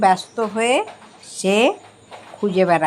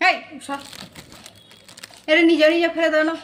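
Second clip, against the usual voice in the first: yelling across the room; 7 semitones higher, much faster than usual. Real, not acted. Shooing cat, asking to take mouse.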